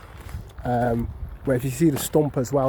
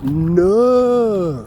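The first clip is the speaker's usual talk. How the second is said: long falling Yorkshire "no", pitched up; 5 semitones higher, 6 dB louder than usual. Shown as a demonstration. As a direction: drawl "no" like a Yorkshireman. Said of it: "in Yorkshire people speak like that".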